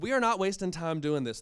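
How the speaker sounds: loud, sharp scolding mother; stern. Acted. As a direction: snap at the daughter. Mother snapping in story.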